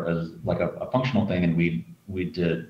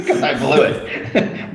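laughing; 7 semitones higher, 5 dB louder, slower than usual. Real, not acted. Secret blown, laughing.

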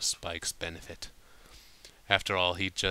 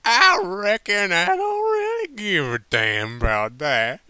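put-on drunk Applejack; 12 semitones higher, 11 dB louder than usual. Tipsy Applejack brushing off Rarity, loud and high. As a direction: toss it off tipsily.

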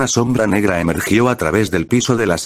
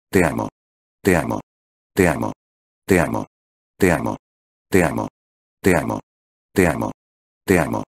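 low, murmured love from the shadow; a dark, low murmur that clings; witch's murmur; 7 semitones lower than usual. Acted. The witch's shadow murmurs love to Subaru, low and hushed. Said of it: "los susurros de la sombra negra".

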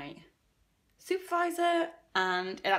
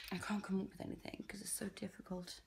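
whisper; 10 dB quieter than usual. Real, not acted.

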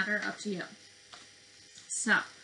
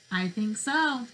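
put-on lively, sing-song picture-book voice for little kids; reading to children; 5 dB louder than usual. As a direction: read it like circle time, drawing them in.

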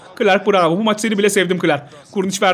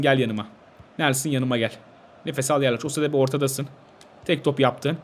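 softer and lower, soothing the team; calming; 7 semitones lower, 6 dB quieter than usual. Real, not acted. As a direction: settle them down gently. Calming team near the end.

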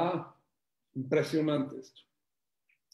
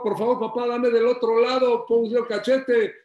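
louder, higher eager plea; a boy begging his father to slap him; urgent child pleading to papá; 4 semitones higher, 7 dB louder than usual. Acted.

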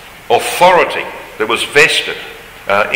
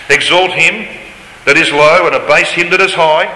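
raised, solemn Bible reading voice; scripture reading; 5 semitones higher than usual. Acted. Reads scripture aloud solemnly.

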